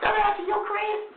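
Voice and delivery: put-on high girly voice, up in falsetto; girl voice. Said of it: "He hit the little, the girl voice".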